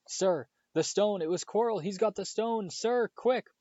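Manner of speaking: high, loud, breathless urgency; urgent panic. A narrator's reading. Harry urgently warning Dumbledore about the stone.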